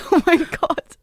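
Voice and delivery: laughing. Real, not acted. Reagiert lachend auf den Abschiedsgruß.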